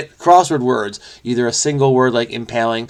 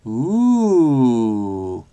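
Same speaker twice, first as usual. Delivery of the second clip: long swooping mock-impressed ooh; drawn-out "ooh". Reader reacting to Siamese fighting fish, drawn-out ooh. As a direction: let an ooh rise and slide slowly down.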